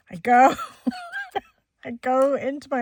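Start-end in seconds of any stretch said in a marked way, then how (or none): 0.6-1.4 high, helpless giggle; leftover giggle. Real, not acted. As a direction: let a squeaky laugh escape mid-story. Still tickled by the story.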